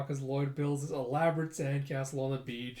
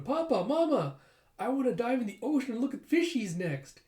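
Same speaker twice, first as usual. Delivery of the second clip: put-on high, loud little-kid voice; eager kid announcing a wish; excited child calling; 9 semitones higher, 4 dB louder than usual. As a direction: pipe up eagerly to both parents. Child calls parents, begging.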